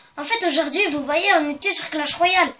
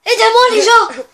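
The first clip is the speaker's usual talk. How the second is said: high, loud hammed-up cry for help; mock panic; 7 semitones higher, 10 dB louder than usual. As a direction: wail for help like a comic victim. Joking about his fallen muscles.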